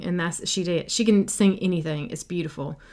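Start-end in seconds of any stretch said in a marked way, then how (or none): none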